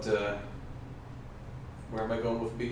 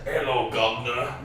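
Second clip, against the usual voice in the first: mock English accent. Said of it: "Give me your best English accent".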